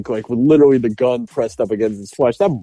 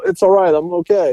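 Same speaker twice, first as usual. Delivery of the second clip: higher-pitched call from down the hall, imitated; 7 semitones higher than usual. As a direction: mimic a reassuring call from afar. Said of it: "down the hall go".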